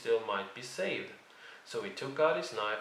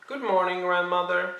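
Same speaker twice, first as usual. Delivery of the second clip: higher, louder call into the cottage; 7 semitones higher, 6 dB louder than usual. A narrator's reading. Girl calling a greeting into the cottage, louder. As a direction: call out brightly to grandmother. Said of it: "she called".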